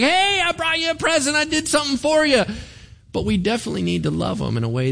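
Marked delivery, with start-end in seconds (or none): 0.0-2.4 much higher, bright eager gift-giving voice; sweet eager giver. Retold or imitated. Pastor mimics a well-meaning believer at work, higher eager voice. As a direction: beam like a kid handing over a present. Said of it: "It's very sweet that you're like".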